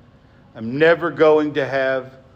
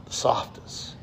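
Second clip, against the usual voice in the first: whispered; 8 dB quieter than usual. Shown as a demonstration. God speaks to us the softest.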